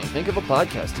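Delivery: ordinary talk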